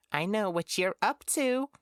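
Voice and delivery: high, gleeful sing-out; annoying little tease. A narrator's reading. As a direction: taunt them in a high, annoying voice. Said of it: "giggled in an annoying little giggle".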